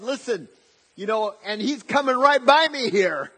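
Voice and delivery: imitating a desperate beggar shouting back; acted desperation. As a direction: refuse to be shushed, insist loudly. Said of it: "he wasn't doing it. He was desperate".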